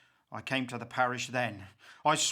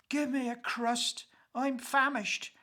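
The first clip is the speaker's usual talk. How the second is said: higher, strained plea for bread; starving wife groaning; 7 semitones higher than usual. A narrator's reading. Dying wife begs her husband for food, strained and high. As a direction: beg for a crust as if starving. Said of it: "she groaned".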